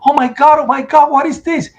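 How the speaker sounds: high, horrified cry of shock; horrified scream. Retold or imitated. Survivor seeing the bodies after the collapse, horrified high cry. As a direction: cry out in horror at what you see. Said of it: "I started screaming".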